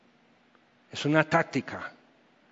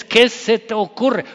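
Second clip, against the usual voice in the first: louder, higher mock telling-off; mock scolding; 6 semitones higher, 7 dB louder than usual. The scolding parents expected, mock angry yell. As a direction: scold them like an angry pastor. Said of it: "las venas saltadas así".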